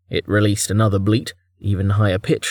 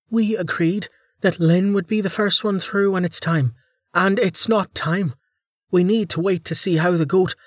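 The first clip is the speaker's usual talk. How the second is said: high-pitched character voice, measured objection; lightly voiced leader, pitched high and insistent; composed leader Vanna, objecting; 8 semitones higher than usual. Vanna objects to Starvo going first, firmly.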